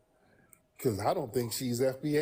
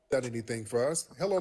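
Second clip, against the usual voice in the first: ordinary delivery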